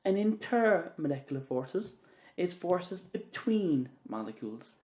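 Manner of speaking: slow, between said with weight; stress on between. Shown as a demonstration. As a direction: press down on between, slowly. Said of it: "emphasis on the word between".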